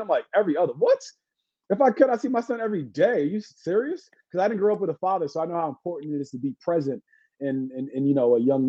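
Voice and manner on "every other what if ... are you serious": higher-pitched mock indignation; incredulous retort. Co-parenting dad answering assumptions, mock incredulous. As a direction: act out an affronted, disbelieving comeback.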